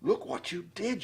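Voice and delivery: a touch of angry blame; mild shout. Acted. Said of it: "shouted Brother".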